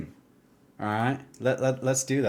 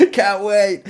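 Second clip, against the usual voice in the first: excited; 8 semitones higher, 7 dB louder than usual. Real, not acted. Excited about game release.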